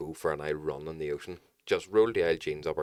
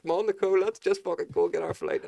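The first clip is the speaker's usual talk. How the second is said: urgent high call; 11 semitones higher than usual. Retold or imitated. Urging wife to flee.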